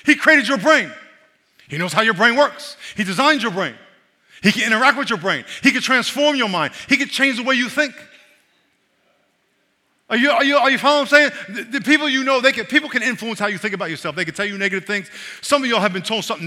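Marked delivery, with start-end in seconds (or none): 0.0-11.3 loud, high preaching voice; forceful preaching. Acted.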